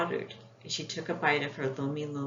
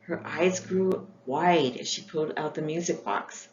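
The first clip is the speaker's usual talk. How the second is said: plain reading voice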